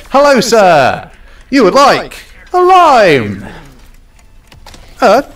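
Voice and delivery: mock waiter voice. Greeting a customer in a put-on voice.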